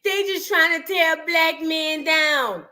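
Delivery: slow, mocking take on their excuse; mock defensive fans. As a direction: drag it out as a parody of fans. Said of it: "But y'all want us to say".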